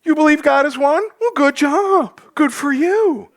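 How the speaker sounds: a sarcastic two thumbs up; sarcastic praise. Pretend. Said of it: "when someone ever dropped that on you".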